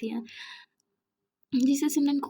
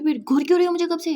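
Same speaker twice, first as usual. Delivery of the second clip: fast, louder, higher snap; quick, raised, accusing question; irritated accusation; 6 semitones higher, 9 dB louder, faster than usual. Acted. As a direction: fire it back, annoyed. Irritated girl accusing friend.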